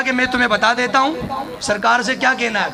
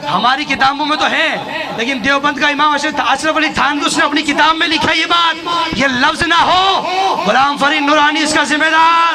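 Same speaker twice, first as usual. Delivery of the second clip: raised voice, building in force; bold, forceful preaching; 4 semitones higher, 3 dB louder than usual. Real, not acted. Preacher citing a rival scholar's book, boldly forceful. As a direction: declare it boldly, climbing higher as you go. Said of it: "बड़ी धड़ल्ले से बात करने लगा हूँ".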